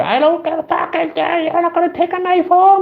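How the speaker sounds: very high, loud squealing rage; hammed-up enraged girl refusing; mock ballistic girl. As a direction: go way up and shriek like she lost it. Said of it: "she went ballistic".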